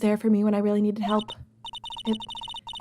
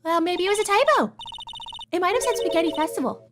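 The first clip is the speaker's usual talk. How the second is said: put-on bright young girl, pitched way up; high girly voice; 9 semitones higher than usual. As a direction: voice Maya, light and cheeky. Maya voice high.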